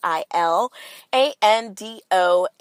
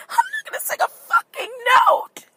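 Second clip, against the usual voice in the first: hammed-up tearful shout. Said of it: "I'm getting choked up".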